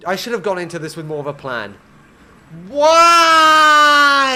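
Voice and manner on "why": long, high drawn-out howl; despairing scream. Real, not acted. Streamer regretting having no plan, screaming in despair. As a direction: scream it out and hold the vowel.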